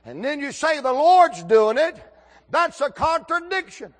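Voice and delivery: high, loud challenge; loud mock objection. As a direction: object like you caught him out. Acts out an objection in a debate.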